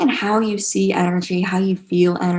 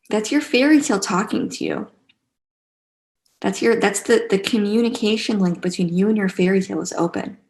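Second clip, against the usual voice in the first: ordinary talk, nothing marked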